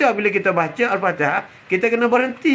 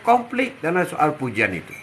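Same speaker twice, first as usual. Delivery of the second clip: ordinary talk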